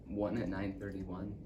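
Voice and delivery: low count dying away; hushed, fading. Acted. Boy listing his dad's phone messages, hushed.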